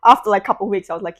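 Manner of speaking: ordinary talk, nothing marked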